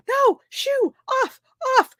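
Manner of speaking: high, loud squawks in short bursts; old couple shooing. Retold or imitated. Old couple at picnic table shooing dogs, high frantic yelling. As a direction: shoo a dog away in a high yelp. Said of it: "this old lady and her husband".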